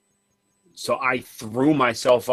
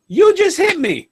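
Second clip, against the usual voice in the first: put-on crying child; 7 dB louder than usual. Crying child voiced in story.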